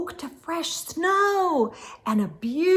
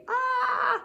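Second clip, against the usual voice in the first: sudden high yelp of shock; startled cry; 11 semitones higher, 5 dB quieter than usual. Acted. Moose startled by skier.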